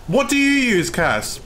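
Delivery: pressing the question in disbelief; exasperated. Real, not acted.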